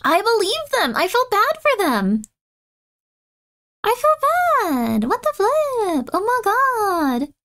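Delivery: high, slow mock-sorry whine; mock pity. Streamer whining high and slow over a fake shutdown. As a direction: wail in mock sympathy at the lie. Mock sympathy for deceived company.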